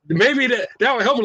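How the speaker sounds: ordinary talk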